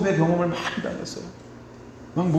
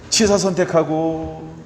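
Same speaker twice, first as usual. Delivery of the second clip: ordinary delivery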